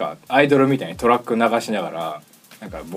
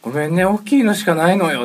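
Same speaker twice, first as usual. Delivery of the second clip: put-on higher, louder sweet old-lady apology; granny voice; 10 semitones higher, 7 dB louder than usual. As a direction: play the granny apologizing for the big bill. Granny hands over a big bill.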